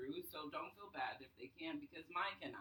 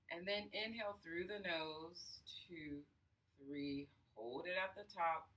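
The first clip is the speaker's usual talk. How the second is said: guided breathing. Acted. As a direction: count the breaths, unhurried and soothing. Leads a breathing exercise.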